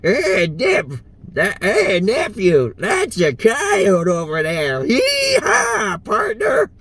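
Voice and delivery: high, whooping hillbilly voice; Uncle Nexus. Acted. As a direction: holler like a hillbilly farmer, soaring into a shrill hee-haw. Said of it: "he's really hillbilly-like".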